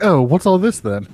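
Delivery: high, loud put-on British voice; mock British. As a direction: play a puzzled Brit, hammed up. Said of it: "British reaction to it".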